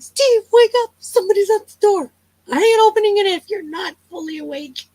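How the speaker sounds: half-laughing frightened urging; urgent waking up husband. Retold or imitated. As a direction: shake him awake, scared.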